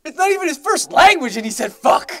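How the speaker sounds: high, loud astonished retelling; retelling her own reaction, high and loud; amused disbelief. Retold or imitated. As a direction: marvel at it out loud. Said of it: "And I was like".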